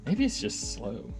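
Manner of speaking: normal talking voice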